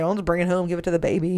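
normal talking voice

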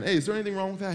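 ordinary talk

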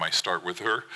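plain reading voice

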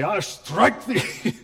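slow, hammed-up thundering "I strike thee"; a hint of a wrathful deity; mock stern God. God answering Abraham in mock wrath, slow. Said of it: "And God didn't say".

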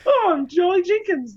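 high, slow, squeaky mock innocence; hammed-up chirpy introduction; nervous mock reply. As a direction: play it like a dopey, cheerful liar.

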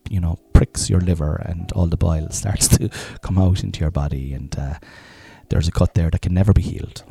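normal talking voice

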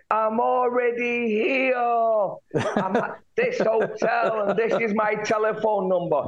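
exasperated. Retold or imitated. Exasperated reply to absurd request.

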